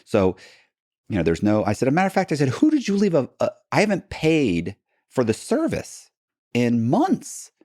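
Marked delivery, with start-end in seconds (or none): 1.9-7.5 gleeful reveal. Retold or imitated. Revealing the fake bank.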